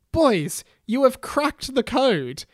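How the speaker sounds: high, loud, grand announcement; Hermione's proclamation. A narrator's reading. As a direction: declare it emphatically to the boys. Voices Hermione addressing the boys.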